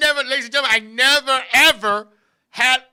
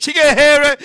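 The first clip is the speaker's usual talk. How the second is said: normal talking voice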